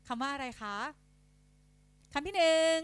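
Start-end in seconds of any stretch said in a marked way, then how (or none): 2.1-2.8 higher, louder classroom announcing; dictation voice. Shown as a demonstration. Teacher giving pupils a spelling test, dictation voice. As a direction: call it out for the class to write. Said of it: "ยกตัวอย่างเช่น อ่ะ ไหนลองเลือกมาสิ".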